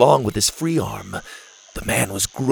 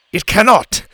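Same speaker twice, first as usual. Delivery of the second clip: loud, raised-pitch insistence; firm refusal; 9 semitones higher, 6 dB louder than usual. A narrator's reading. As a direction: put your foot down over the radio. Boom firmly refuses over radio.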